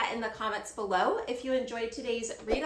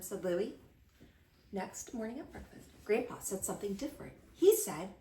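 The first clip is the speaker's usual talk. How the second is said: plain reading voice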